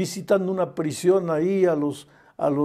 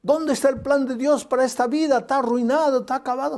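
higher, taunting devil voice; a lightly voiced, higher gloating devil; 7 semitones higher than usual. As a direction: gloat like the devil over a victory he thinks is won. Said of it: "el diablo dijo".

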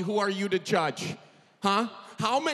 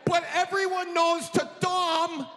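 exasperated friend voice; 7 semitones higher than usual. Retold or imitated. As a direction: insist like a stubborn friend. Acted quote, irritated friend.

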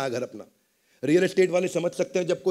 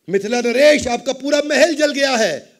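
alarmed announcement; 8 semitones higher, 11 dB louder than usual. Acted. Messenger announces palace fire.

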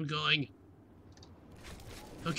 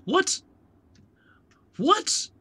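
very high, rising yelp; baffled disbelief; 5 dB louder than usual. Real, not acted. Player confused by the game's time of day, baffled. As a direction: squeak it out in utter disbelief.

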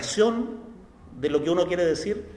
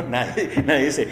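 ordinary talk, nothing marked

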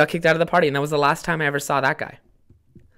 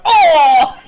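loud, high "Oh!" of a shocked crowd with a laugh; crowd gasp; 12 semitones higher, 13 dB louder than usual. Retold or imitated. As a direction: gasp like a whole party watching. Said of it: "everyone went".

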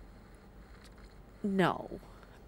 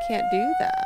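squeaky high voice; 5 dB louder than usual. Real, not acted. Reacts to alarm announcement.

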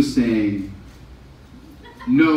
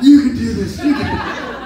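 mock pep talk; 9 semitones higher, 3 dB louder than usual. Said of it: "watch him be like".

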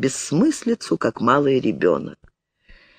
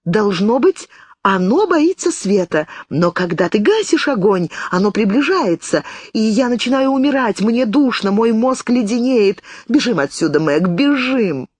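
stubborn fear; 7 semitones higher than usual. A narrator's reading. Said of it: "упорствовала младшая сестра".

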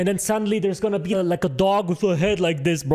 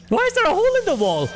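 high, fast alarmed question; 11 semitones higher, much faster than usual. Real, not acted. Gamer alarmed at the hole in the wall, high fast question. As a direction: blurt it out pitched up.

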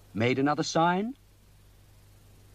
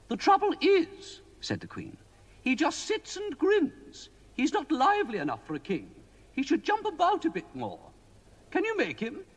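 high, fussy queenly voice; a worried queen pleading with the wizard; 11 semitones higher than usual. A narrator's reading. Queen complaining to Mister Ben about the king, high queenly voice.

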